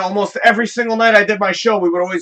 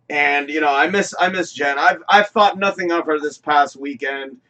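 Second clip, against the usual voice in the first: normal talking voice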